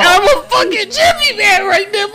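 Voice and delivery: high, crying wail; mock sobbing. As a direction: whine it like fake tears. Crying protest in banter.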